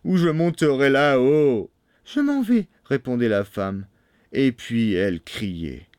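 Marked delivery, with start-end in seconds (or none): 0.0-1.7 shouting. A narrator's reading. Bluebeard threatening to come up, shouting. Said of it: "criait la Barbe Bleue". 2.0-2.7 very high falsetto reply; pleading wife. A narrator's reading. Wife answering Bluebeard, high and anxious.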